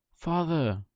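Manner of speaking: high call across the water; high, searching call. Acted. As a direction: call out for a lost father. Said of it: "he called out".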